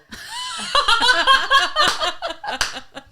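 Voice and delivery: loud high burst of laughter. Real, not acted. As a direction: laugh hard at the joke. Laughs at own joke.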